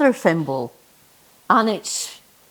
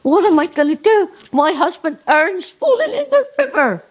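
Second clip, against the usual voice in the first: panicked Jenny; 5 semitones higher than usual. Acted. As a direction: flap like a flustered old lady. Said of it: "Jenny goes".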